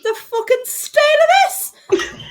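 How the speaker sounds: high, loud laughing disgust; disgusted shriek. Acted. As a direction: shriek at the leeches, half laughing. Leeches found on own fur.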